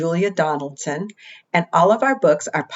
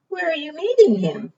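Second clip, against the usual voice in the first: owl voice; 10 semitones higher than usual. Acted. Owl asks mouse.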